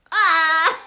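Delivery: loud shriek into laughter; laughing shriek. Real, not acted. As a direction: let out a yelp and crack up. Reacting to the punchline.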